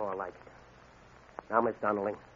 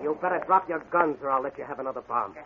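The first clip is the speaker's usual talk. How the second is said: ordinary delivery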